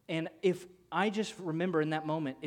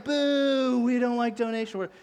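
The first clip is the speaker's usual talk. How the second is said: loud, high mock booing; kids yelling; 7 semitones higher, 7 dB louder than usual. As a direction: jeer like a crowd of kids. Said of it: "they're, like, yelling".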